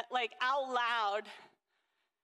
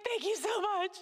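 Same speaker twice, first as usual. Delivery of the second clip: higher, tender, teary voice; thanking her son through emotion; tearful thanks; 8 semitones higher than usual. Retold or imitated. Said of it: "I was like".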